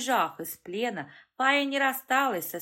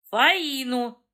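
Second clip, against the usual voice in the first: louder, higher growl; growled correction; 5 semitones higher, 8 dB louder than usual. A narrator's reading. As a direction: snap out her name. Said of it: "рыкнул Дим".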